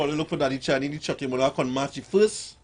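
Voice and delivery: usual speaking voice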